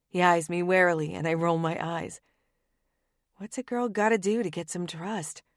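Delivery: ordinary delivery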